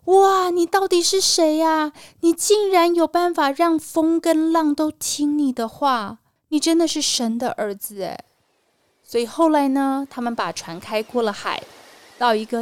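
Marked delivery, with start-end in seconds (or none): 0.1-8.2 amazed. Acted. Men on the boat marvel at Jesus after the storm stops, amazed. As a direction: marvel out loud in awe. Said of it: "更惊讶了".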